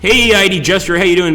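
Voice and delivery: ordinary delivery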